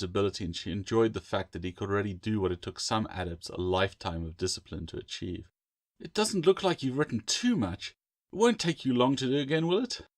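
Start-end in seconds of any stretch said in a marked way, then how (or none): 6.0-10.1 put-on higher, coaxing young woman; lightly voiced sister; sister's voice. A narrator's reading. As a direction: lift the end like a question to her brother.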